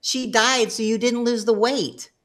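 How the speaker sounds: mock the counselor's oblivious pronouncement; imitating the counselor's blunt verdict; clueless counselor. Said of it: "And he goes".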